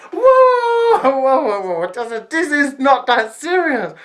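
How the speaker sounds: high, loud whoa, then urgent objection; alarmed protest. Retold or imitated. Girl stopping auntie threatening to hit, alarmed. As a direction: shoot up high to halt the attack.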